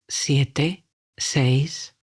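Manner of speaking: ordinary talk, nothing marked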